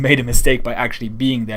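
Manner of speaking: normal talking voice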